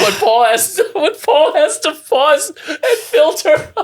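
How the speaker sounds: high, loud laughing tease; cracking up. Real, not acted. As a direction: squeal it out through helpless laughter. Cracks up teasing co-host.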